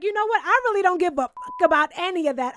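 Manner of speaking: loud, heated mock rant; retelling a furious husband; angry outburst. Alan's angry outburst retold.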